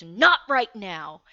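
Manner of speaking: imitating mom's loud, drawn-out brush-off; loud, slow telling-off; sharp rebuke. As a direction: fend someone off loudly, stressing each word. Mom whips around, snaps at dad.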